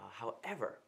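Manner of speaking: ordinary talk, nothing marked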